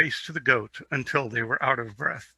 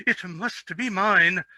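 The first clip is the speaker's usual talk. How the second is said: higher, slow, greedy little prince; spoiled prince; 6 semitones higher, slower than usual. A narrator's reading. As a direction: drag out the childish demand. Spoiled prince claims goat.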